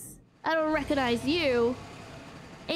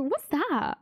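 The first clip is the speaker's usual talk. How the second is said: ordinary delivery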